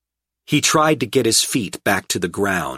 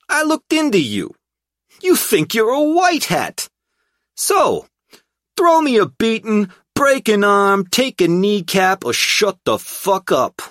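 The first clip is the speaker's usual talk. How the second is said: high, loud brash thug; relieved brash hoodlum voice; 13 semitones higher, 5 dB louder than usual. A narrator's reading. Tripp character voice.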